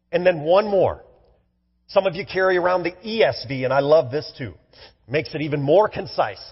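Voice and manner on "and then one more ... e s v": normal talking voice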